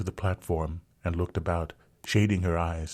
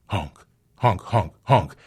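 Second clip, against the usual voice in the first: loud mad honking; 2 semitones higher, 8 dB louder, slower than usual. A narrator's reading. Narrator mimics a horn, loud honking. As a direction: blare the honks like a wild horn.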